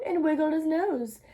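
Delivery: usual speaking voice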